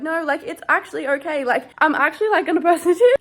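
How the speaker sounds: high, increasingly loud retold plea; desperate pleading. Woman telling a stranger she'll burst into tears, desperate pleading. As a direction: build from insistent refusal to overwhelmed outburst.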